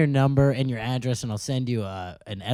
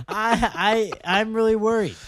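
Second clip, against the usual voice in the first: higher, stammering worry; mock worried; 8 semitones higher than usual. Coworker answering the man who broke down, mock worried. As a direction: play at being nervous, pitched up. Acting the scared coworker's reply.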